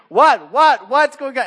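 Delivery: high, loud, alarmed mock yell; mimicked frantic shouting; imitated screaming. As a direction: yell it like a startled dad in another room. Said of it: "I hear my dad screaming".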